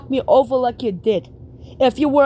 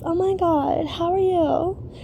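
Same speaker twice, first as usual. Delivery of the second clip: higher, put-on gushing; sugary fake concern, pitched up; fake sweet voice; 4 semitones higher than usual. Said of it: "They just like this".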